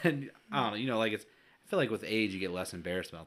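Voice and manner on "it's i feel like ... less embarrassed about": normal talking voice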